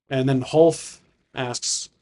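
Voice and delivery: ordinary delivery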